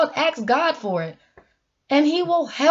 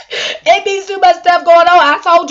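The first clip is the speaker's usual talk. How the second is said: laughing; 7 semitones higher, 8 dB louder than usual. Real, not acted. Laughs mid-line.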